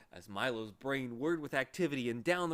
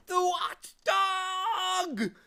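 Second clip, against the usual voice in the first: high, loud frightened cry; terrified shout; 9 dB louder, much slower than usual. Acted. Lethargarian fainting from fright, shouting high. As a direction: scream the name in fright. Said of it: "shouted another".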